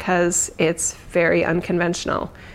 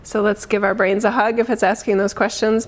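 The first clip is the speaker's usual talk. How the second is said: ordinary talk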